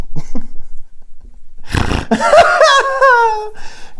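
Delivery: long, high cackling laugh; cackle. Acted. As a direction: cackle hard at your own joke. Forced laugh at own line.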